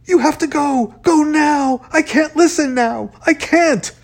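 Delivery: high, loud desperate shouting; panicked. A narrator's reading. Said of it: "panic threatened to overwhelm him".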